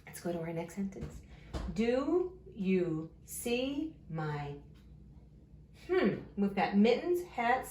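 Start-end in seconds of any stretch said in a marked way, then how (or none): 1.8-4.5 very slow, each word stretched; drawn-out early-reader pacing; word-by-word reading. Shown as a demonstration. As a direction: read along slowly, one word at a time. Sounding out a sentence word by word.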